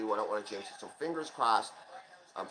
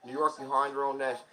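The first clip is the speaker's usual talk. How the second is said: ordinary talk, nothing marked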